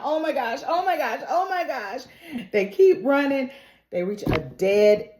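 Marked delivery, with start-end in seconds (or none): 0.0-2.0 higher, mild mock panic; acted fright. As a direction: repeat it like someone scared, lightly hammed-up. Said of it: "she is just scared shitless".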